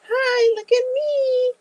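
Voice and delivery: very high, slow squeaky card voice; cute cards' voice. Pretend. Collectible cards calling out to buyer, squeaky and cute. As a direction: squeak like a toy begging to be picked. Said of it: "And now here comes".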